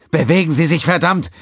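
loud, high furious yell. Acted. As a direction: roar it with aching lungs. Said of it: "brüllte er mit schmerzenden Lungen".

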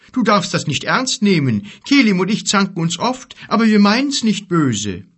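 high, loud giant explanation; conciliatory giant. Acted. Giant excusing the quarrel to the boy, conciliatory.

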